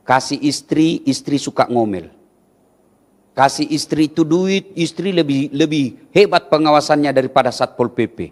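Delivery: ordinary delivery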